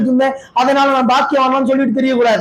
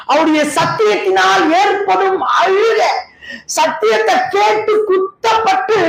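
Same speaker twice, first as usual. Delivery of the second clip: crying shout; 5 semitones higher than usual. Acted. Preacher acting the convicted sinner, crying and shouting.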